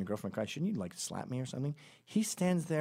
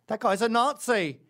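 loud, high mocking yell; yelled accusation; 9 semitones higher, 9 dB louder than usual. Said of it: "They yell that from the lineup".

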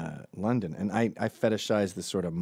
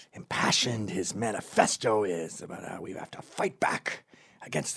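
rising, hammed-up revolutionary rant climbing higher to a shout; mock impassioned blogger; 4 semitones higher, slower than usual. Mocking a beta blogger's manifesto, rising to a shrill yell. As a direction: ham up a beta blogger's battle cry.

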